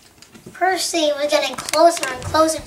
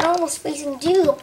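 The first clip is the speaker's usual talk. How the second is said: ordinary delivery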